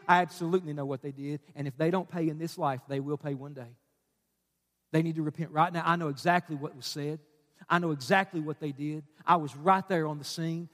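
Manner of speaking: low, quiet assurance; God's voice. Acted. Preacher voices God answering the wronged believer, low and quiet. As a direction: answer the outburst softly, pitch well down. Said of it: "God says this".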